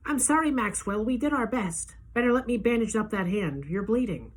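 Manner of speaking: a doctor breaking bad news; sorry doctor. A narrator's reading. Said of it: "she's saying".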